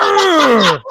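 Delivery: loud, high "Ugh!"; imitated pained groan. Co-host copying Jason in pain, loud groan. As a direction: mimic a hurt slasher's groan. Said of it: "when he screams in pain".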